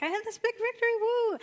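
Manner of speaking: high, loud triumphant cheer; victory whoop. Retold or imitated. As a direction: celebrate the big win with a whoop. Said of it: "He's, he's like".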